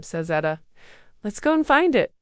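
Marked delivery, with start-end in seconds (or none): 1.2-2.1 high loud eager urging; excited call. A narrator's reading. Eager girl urges search.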